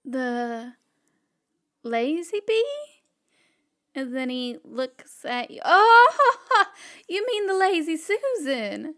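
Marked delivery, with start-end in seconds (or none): 5.6-8.9 surprised laugh. Acted. Fran laughs at the mistake.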